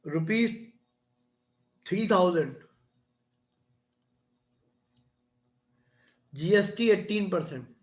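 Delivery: measured classroom dictation, piece by piece; slow dictation. Real, not acted. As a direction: dictate slowly, with long pauses between phrases. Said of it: "मैं धीरे धीरे बोलता हूँ". (धीरे-धीरे, रुक-रुक कर लिखवाते हुए)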